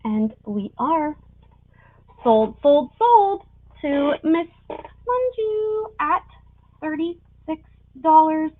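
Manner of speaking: rising auctioneer call, going once, twice. Real, not acted.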